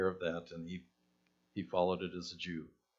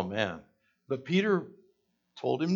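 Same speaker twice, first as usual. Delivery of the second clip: ordinary talk, nothing marked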